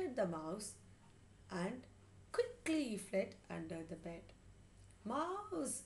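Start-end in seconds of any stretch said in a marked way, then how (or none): 5.1-5.8 very high drawn-out cry; screamed. A narrator's reading. Mithun runs behind the cat, screamed. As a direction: scream it in alarm, falling at the end. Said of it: "screamed Mithun".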